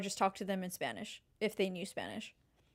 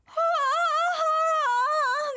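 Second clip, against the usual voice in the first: high squealing laugh; 6 dB louder than usual. Real, not acted. Delighted by the chocolate balls.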